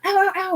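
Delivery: high, sharp mock cries of pain; imitated pained cries. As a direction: yelp like someone falling and getting hurt. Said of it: "them falling in your body saying".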